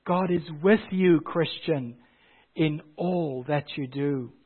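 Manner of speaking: tender quiet refrain after the preaching; hushed reassurance. Acted. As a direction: bring it down to a gentle promise. Refrain dropped to hushed assurance.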